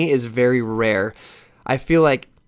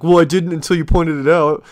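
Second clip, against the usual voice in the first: friends' voice; 3 semitones higher, 5 dB louder than usual. Retold or imitated. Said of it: "everyone's like".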